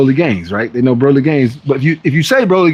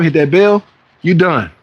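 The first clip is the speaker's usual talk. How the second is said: ordinary talk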